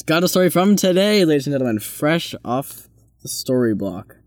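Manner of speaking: big showy intro, slowing down at the end; mock announcer. Host introducing Toby's story, mock announcer.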